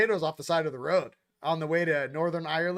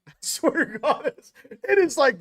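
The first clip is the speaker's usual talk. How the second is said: words bubbling with laughter; laughing as it builds louder; 9 semitones higher, 8 dB louder than usual. Real, not acted. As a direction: talk through a high laugh. Laughing while speaking.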